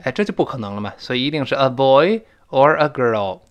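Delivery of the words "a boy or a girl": put-on "boy" lifted, "girl" falling firmly; falling choice intonation. Shown as a demonstration. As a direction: close the choice with a fall on "girl". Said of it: "是降调".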